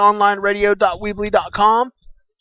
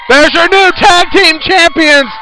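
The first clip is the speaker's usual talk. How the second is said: slow, high, loud proclamation; excited announcer; 12 semitones higher, 10 dB louder, slower than usual. Acted. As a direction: crown the champions. Announcing the champions.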